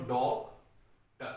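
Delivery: 'डॉक', a clear pause, then 'टर'; syllable split. Shown as a demonstration. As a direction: divide the word into 'डॉक' and 'टर'. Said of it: "इसको ऐसे divide कर लो". ('डॉक' और 'टर' के बीच रुकें)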